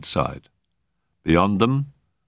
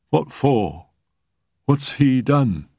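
stunned, slowing to a higher, halting question; dazed; 8 semitones higher, much slower than usual. Acted. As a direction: ask it as if the floor just dropped. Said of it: "asking in a dazed voice".

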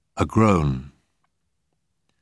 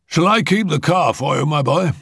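high-pitched gentleman's offer; high elderly-uncle character voice; courteous uncle; 10 semitones higher, 4 dB louder than usual. A narrator's reading. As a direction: pitch it up, avuncular and formal. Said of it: "he asked as they alighted".

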